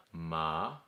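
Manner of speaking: "ma" starting low and rising; second tone. Shown as a demonstration. Teacher demonstrating ma for hemp, rising tone. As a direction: let "ma" rise up from low. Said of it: "let's do them all together".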